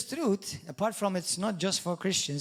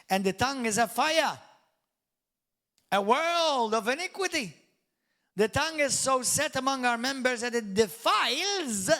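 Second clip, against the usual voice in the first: louder, higher declaiming of scripture; scripture reading; 4 semitones higher, 5 dB louder than usual. Acted. Preacher reads James chapter three to the church, proclaiming. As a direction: proclaim the verse to the whole hall.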